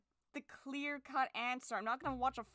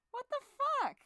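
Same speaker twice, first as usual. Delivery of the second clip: sudden high, loud "what the fuck" burst; frustrated outburst; 13 semitones higher, 6 dB louder than usual. Real, not acted. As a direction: blurt it out in real disbelief, much higher and louder. Sudden outburst over lost color.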